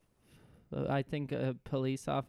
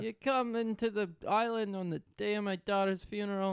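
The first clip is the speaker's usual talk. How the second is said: lightly hammed New Jersey mobster; mock mob accent; 6 semitones higher than usual. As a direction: complain like a wounded mob boss. Co-host joins mob boss bit.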